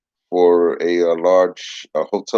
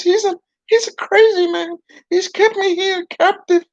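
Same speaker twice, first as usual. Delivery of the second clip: much higher puppet pleading in mock panic; a captive dummy crying for help.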